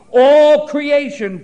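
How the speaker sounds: slow booming preacher's cry; loud drawn-out proclamation. Acted. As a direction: proclaim it like a cry across the whole world. Preacher dramatizes creation groaning.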